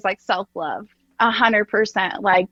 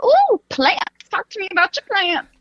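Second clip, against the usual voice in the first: excited; 10 semitones higher than usual. Retold or imitated. Excited quoted reaction.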